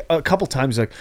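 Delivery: ordinary talk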